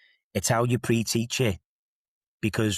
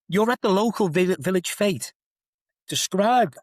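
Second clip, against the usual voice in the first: mock exam paper; 9 semitones higher than usual. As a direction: send up a prim test question in a lightly posh voice. Mocking an out-of-touch exam question.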